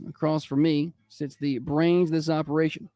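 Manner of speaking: ordinary talk, nothing marked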